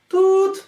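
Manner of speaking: one high-pitched phone tone; phone ring tone. Acted. Imitates the phone ringing tone. (vysoké napodobené tútnutí telefonu)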